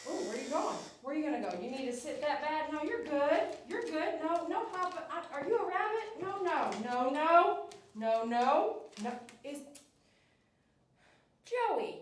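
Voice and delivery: high, singsong dog talk; pet voice. Real, not acted. Groomer coaxing the restless dog, high singsong pet voice.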